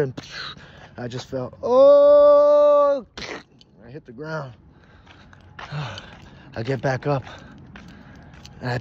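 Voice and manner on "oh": long, high, loud falling wail; falling scream. Retold or imitated. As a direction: scream as you drop from the sky. Falling from the ship.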